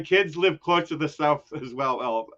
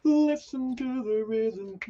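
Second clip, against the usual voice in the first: mock announcer; 8 semitones higher, 4 dB quieter, slower than usual. Echoing 'the rhythm' in a put-on voice.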